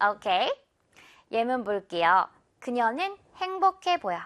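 ordinary talk, nothing marked

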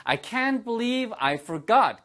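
teacher models it slowly for repetition; slow model sentence. Shown as a demonstration. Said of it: "발음 주의하세요".

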